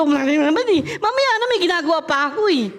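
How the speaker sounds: put-on high, fast put-off child voice; mock lazy child complaining; whiny refusal. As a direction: brush off the errand like a bratty kid.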